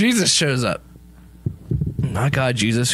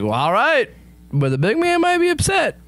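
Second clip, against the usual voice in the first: reluctant Jesus; 12 semitones higher than usual. Acted. Jesus warns Darwin about the big man, rising pitch. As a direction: voice climbing high on a warning. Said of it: "So then Jesus is like".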